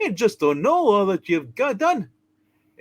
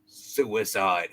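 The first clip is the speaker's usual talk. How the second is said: put-on much lower, grumbling old man voice; gruff old bridge keeper; 6 dB quieter than usual. Old bridge keeper scolding the stranger, low and gruff. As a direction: growl it low like a crusty old tollkeeper.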